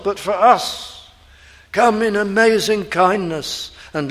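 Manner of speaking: loud, ringing pulpit preaching; full-force preaching. Acted. Preacher to the congregation, preaching at full force. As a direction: proclaim it to the whole congregation.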